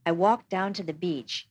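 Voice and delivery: plain reading voice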